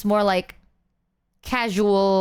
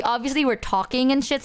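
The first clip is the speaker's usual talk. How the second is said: ordinary talk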